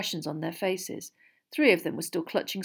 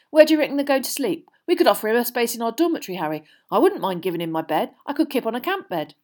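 eager; 5 semitones higher, 5 dB louder, faster than usual. A narrator's reading. Ron eagerly plans hosting his hero.

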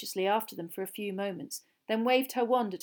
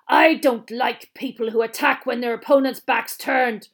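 loud, higher, menacing growl; angry growl; 4 semitones higher, 11 dB louder than usual. A narrator's reading. Teacher punishing a cowardly attacker, growling loudly. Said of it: "growled Moody".

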